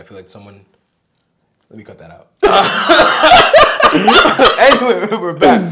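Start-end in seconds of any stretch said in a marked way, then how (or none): 2.4-5.6 loud laughing through words. Real, not acted. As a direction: laugh while getting back on track. Laughing after asking to cut.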